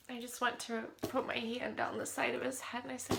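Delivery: ordinary talk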